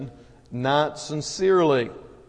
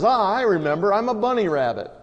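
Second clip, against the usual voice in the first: higher-pitched burst of recognition; delighted realisation; 6 semitones higher than usual. Acted. Rabbit tells the snake he remembers who he is, delighted. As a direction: exclaim it as a happy sudden discovery. Joke character suddenly recalls who he is.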